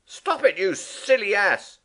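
loud, high yell of alarm; cry out. Acted. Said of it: "cried Rat".

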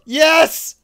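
loud high angry yell; furious one-word shout; exasperated shout. Real, not acted. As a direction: snap back at full volume. Angry answer to friend.

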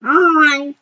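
loud drawn-out greeting. Pretend. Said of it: "speak a little bit louder?".

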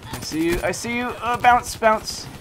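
loud high urgent yelling; shouting hard mid-firefight; raging gamer. Real, not acted. Spotting enemy mid-fight.

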